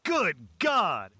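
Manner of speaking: high, loud disgusted shout. Acted. Relatives at the poker table shouting as the smell spreads. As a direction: yelp it out as the stink hits. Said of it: "Shouts of".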